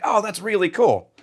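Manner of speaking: high-pitched, retold impressed reply; impressed reaction. Retold reaction to distillery founder.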